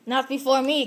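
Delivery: ordinary talk, nothing marked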